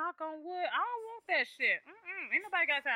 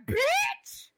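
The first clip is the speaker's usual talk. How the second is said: high, loud yelled insult; hammed-up angry yell; mock outraged yell; 5 dB louder than usual. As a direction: yell it in fake fury. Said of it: "I'm like".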